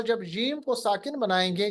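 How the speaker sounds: ordinary talk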